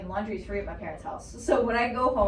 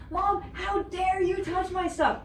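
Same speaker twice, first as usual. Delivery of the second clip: put-on teen outrage; 8 semitones higher, slower than usual.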